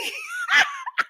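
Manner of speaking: squealing laugh; high burst of laughter. Real, not acted. Laugh after the joke.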